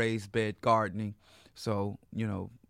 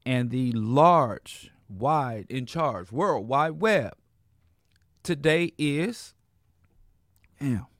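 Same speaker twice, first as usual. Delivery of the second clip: radio announcer; 2 semitones higher, 5 dB louder than usual. Acted. Radio show opening announcement.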